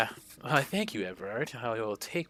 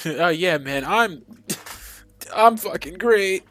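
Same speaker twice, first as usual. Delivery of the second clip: much higher and louder, forced cheer; manic sarcastic shout; 8 semitones higher, 12 dB louder than usual. Acted. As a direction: shout how great you are, clearly lying. [name] overreacts after a laugh.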